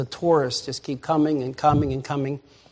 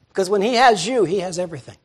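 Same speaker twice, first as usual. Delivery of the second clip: usual speaking voice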